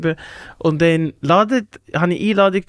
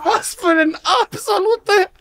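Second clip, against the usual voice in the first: high-pitched mock outrage; appalled scolding; 8 semitones higher than usual. Scolding friend who emptied a fridge, appalled. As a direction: scold him high and appalled, imitating the moment.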